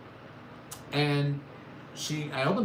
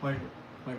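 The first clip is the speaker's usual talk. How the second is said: ordinary talk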